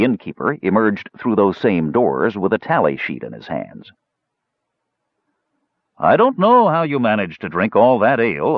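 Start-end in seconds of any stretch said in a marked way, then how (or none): 6.0-8.5 mildly awed, pitched up at the start; trace of awe. A narrator's reading. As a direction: marvel at it, voice lifted. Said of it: "with a trace of awe in his voice".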